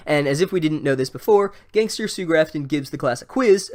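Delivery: ordinary talk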